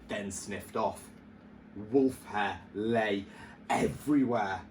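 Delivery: ordinary delivery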